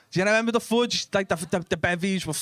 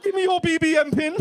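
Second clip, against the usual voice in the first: high-pitched mock London roadman demand; fake London accent; 12 semitones higher, 4 dB louder than usual. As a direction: bluff loudly as a fake Londoner. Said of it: "Of course I'm from London".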